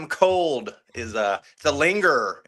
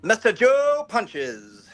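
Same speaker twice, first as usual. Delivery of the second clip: announcer voice; 10 semitones higher, much slower than usual. Pretend. Host introduces himself grandly.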